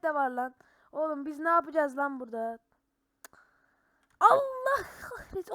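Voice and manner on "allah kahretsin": startled curse. Real, not acted. Startled by monster in game.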